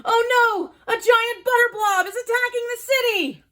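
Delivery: high and fast, raise the alarm; breathless cartoon panic; excited alarm.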